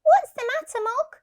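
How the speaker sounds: put-on high, young child asking worriedly; child's voice.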